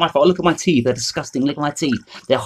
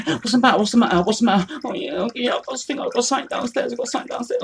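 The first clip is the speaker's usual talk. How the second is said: high, soft mock-panicked whimper; imitating panicky crying; 9 semitones higher, 6 dB quieter than usual. Older brother imitating his crying younger brother, high and soft mock panic. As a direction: play the scared little brother, pitched up and hushed. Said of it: "Like, all crying".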